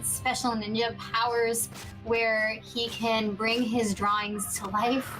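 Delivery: normal talking voice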